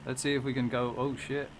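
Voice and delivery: ordinary talk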